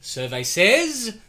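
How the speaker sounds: slow drawn-out announcer build to a loud name; game show reveal. Pretend. Game show host reveal to the panel. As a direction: hosts announce the survey winner. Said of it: "game show reveal".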